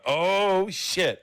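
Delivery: high, loud drawn-out outburst; shocked delight. Real, not acted. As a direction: exclaim it high and loud at a scandalous story. Reacts to the risqué story.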